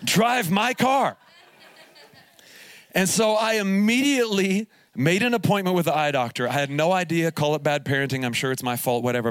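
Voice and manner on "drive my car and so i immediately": slow, laughing through the words. Real, not acted. Pastor laughing while telling the congregation the punchline. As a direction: drag it out, cracking up.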